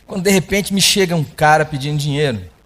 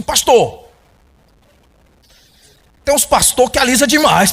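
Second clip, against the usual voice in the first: teasing quote; 6 semitones higher, 4 dB louder than usual. Retold or imitated. The man teases the pastor.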